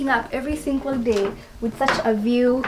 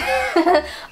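burst of laughter; 7 semitones higher, 5 dB louder than usual. Real, not acted. Laughing at fruit pile.

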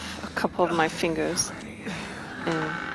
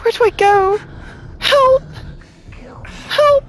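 very high and loud, frantic; panicked screams; 5 dB louder than usual. Real, not acted. Player fleeing the doctor, frantic high screams. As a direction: scream for help while running.

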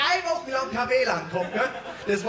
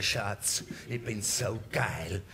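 much lower, slow and softer; husband's voice; 14 semitones lower, 8 dB quieter, much slower than usual. Acted. Dad tells his wife in front of the kids, low and slow.

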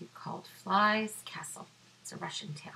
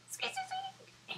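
tiny squeaky squeeze; 8 dB quieter than usual. Acted. Mouse squeezing in.

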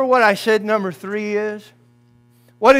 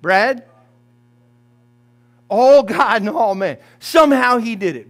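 ordinary talk, nothing marked

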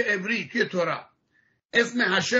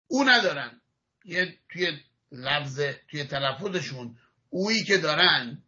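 ordinary talk, nothing marked

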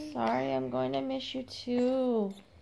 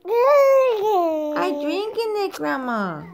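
put-on high, drawn-out baby voice; 9 semitones higher, 10 dB louder, slower than usual. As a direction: voice the baby protesting, high and slow. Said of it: "Grandma said".

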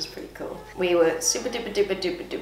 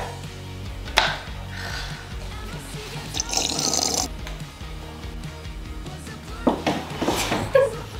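shrill delighted laughter; high squealing laugh; 5 dB quieter than usual. Real, not acted. Laughing at surprise.

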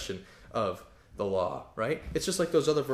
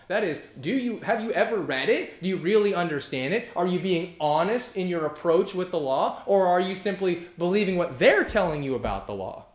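high, loud sermon delivery, driving each question home; fiery preaching; 6 semitones higher, 6 dB louder than usual. Acted. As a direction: thunder at the Galatians from the pulpit. Preaching at full force.

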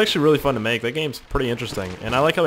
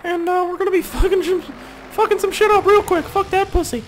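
Mickey Mouse voice; 4 dB louder than usual. Pretend. Keeps the Mickey voice going.

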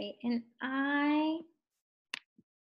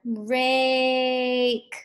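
RAKE with the vowel held level; said slowly; 3 dB louder than usual. Shown as a demonstration. Teacher asking what's in the middle, RAKE said slowly. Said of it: "Say it slowly".

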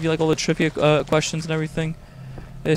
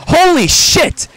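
high, loud, drawn-out startled shout; shocked shout; 11 dB louder, much slower than usual. Real, not acted. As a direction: yell it out in sudden shock. Sudden shock at statue.

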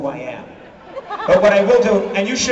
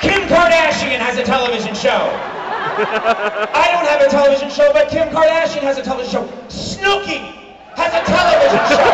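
mock bitter rant; 6 semitones higher, 3 dB louder than usual. Bitter rant about TV shows.

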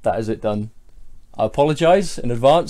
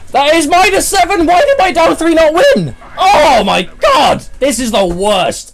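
high, loud furious screaming at the game; mad yelling; 7 dB louder than usual. Real, not acted. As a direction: scream it, losing your temper. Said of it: "Why am I getting so mad?".

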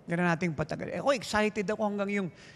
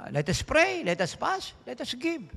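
rallying preacher call; 6 semitones higher, slower than usual. Real, not acted.

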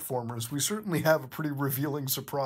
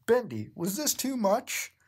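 higher, lightly voiced concerned partner; worried Cuphead; 7 semitones higher than usual. Reader voicing Cuphead checking on Bendy, higher concerned. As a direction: ask gently, a bit high.

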